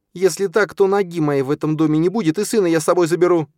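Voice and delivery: loud, high-pitched threat; furious ultimatum. A narrator's reading. Stepmother threatening to leave with her son, furious. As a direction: hurl the ultimatum, speeding up at the end.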